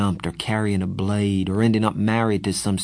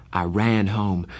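ordinary delivery

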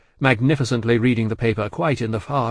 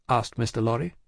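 plain reading voice

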